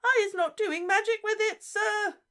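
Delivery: very high, loud squeaky elf voice; Winky denying magic; squealing elf. Acted. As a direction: squeal in panic. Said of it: "squealed Winky".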